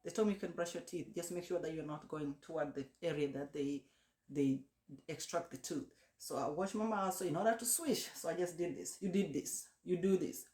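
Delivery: ordinary talk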